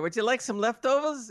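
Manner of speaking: sing-song mock of the aunt offering leftovers; imitating the aunt. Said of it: "So we come over there".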